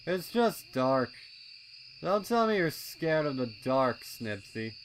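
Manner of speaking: teasing colt. A narrator's reading. Snails teasing Snips about the dark, high and mocking. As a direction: needle your friend about being scared.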